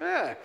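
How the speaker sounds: high-pitched grunt of disgust; disgusted "ugh". Retold or imitated. Priest recoiling as spit flies out of her, disgusted groan. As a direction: recoil with a mock yuck.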